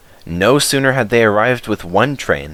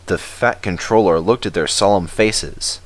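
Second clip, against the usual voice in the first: usual speaking voice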